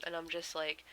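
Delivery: normal talking voice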